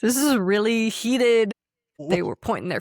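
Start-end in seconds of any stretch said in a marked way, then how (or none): 0.0-1.5 mock heated. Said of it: "And he was like".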